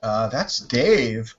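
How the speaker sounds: mock salon worker. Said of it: "people at the hair salon".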